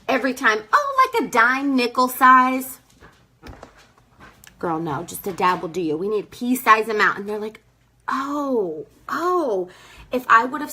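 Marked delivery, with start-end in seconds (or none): 0.7-2.8 lightly voiced customer, higher and louder; imitating a customer answering, slowly; mimicked customer. 8.1-9.7 imitated customer's drawn "oh", higher; lightly acted dawning realization; mock realization. Customers realize their mistake.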